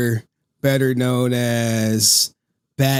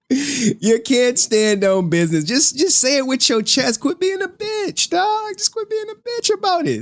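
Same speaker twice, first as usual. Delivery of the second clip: laughing mockery; 12 semitones higher than usual. Real, not acted. Mocking the streamer, laughing.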